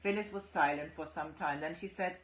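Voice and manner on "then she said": ordinary delivery, nothing marked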